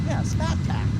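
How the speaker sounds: high, mock Boston "Smart Pack", R dropped; Boston accent. Shown as a demonstration. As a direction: correct him in a Boston accent. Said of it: "Smart Park".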